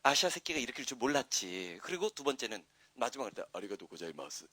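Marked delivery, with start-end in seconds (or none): 3.5-4.5 very low, soft farewell in Japanese; low-voiced Japanese thanks. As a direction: lay the voice down low and quiet. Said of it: "이렇게 딱 나왔어". (낮고 조용하게 깐 목소리로)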